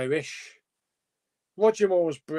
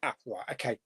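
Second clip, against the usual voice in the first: usual speaking voice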